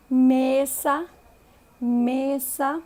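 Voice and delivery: mesa with a closed e and a clear final a; Spanish mesa. Shown as a demonstration. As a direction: say mesa the Spanish way, vowels pure. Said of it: "não muda a pronúncia da vogal".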